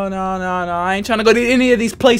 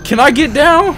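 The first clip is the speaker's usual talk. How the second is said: high, loud and rushed; frantic pleading, scrambling for cover; panicking gamer; 8 semitones higher, 6 dB louder, faster than usual. Real, not acted. Gunfight panic in the game.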